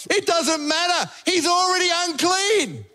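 high, insistent shout, stretched at the end; crowd's protest. Acted. Preacher voices the whole crowd answering, shouting in protest.

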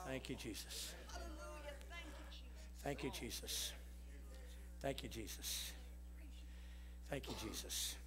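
hushed, murmured thanks; very soft worship murmur; soft prayer. Real, not acted. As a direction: breathe it out as a quiet prayer. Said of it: "Lift up your hand and just say".

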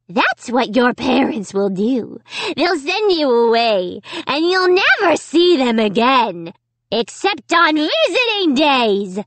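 gloating dummy. A narrator's reading. Dummy telling Amy she'll lose her parents, gloating. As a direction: build from cold menace to high gloating.

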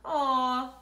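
loud disappointed whining aw; whined aw. A narrator's reading. Laura and Larry whining when told no. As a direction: whine with letdown. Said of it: "whined Laura and Larry".

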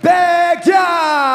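rising to a long high shout; building announcer yell. Acted. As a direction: build to a roaring name call. Announcer builds to name call.

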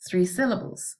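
usual speaking voice

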